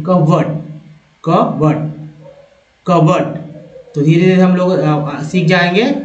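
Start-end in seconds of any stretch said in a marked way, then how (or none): 0.0-3.5 कबर्ड with a short weak second syllable; कबर्ड, not बोर्ड, end reduced; correct pronunciation. Shown as a demonstration. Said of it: "बोर्ड नहीं".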